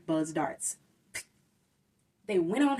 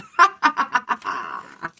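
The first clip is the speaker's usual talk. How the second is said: loud greedy cackle of joy; villainous gleeful laugh; 2 semitones higher, 6 dB louder than usual. Acted. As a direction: laugh over the pile of toys. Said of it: "laughed with joy".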